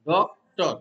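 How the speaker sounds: Doctor split in two, weight on ডক; stress on first syllable. Shown as a demonstration. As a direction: hit the ডক in doctor, then let টর drop. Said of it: "তাহলে ডকটা হচ্ছে stress".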